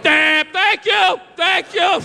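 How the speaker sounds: slow, drawn-out thanks; slow grateful sigh. Retold or imitated. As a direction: savor the relief slowly. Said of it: "It's like".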